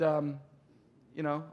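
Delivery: usual speaking voice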